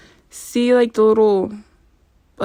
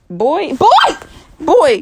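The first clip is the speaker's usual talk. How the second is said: excited exclaiming; 8 semitones higher, 5 dB louder, slower than usual. Real, not acted. Exclaiming over the price.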